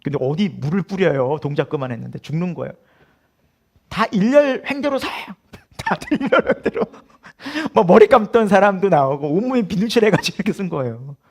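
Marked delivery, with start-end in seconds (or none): 3.9-6.8 higher-pitched drill command; retold military order. Instructor lining up naked recruits, mock command. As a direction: order the troops into line, mimicking an instructor.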